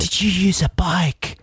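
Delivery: whisper. Pretend. Teasing whisper to co-host.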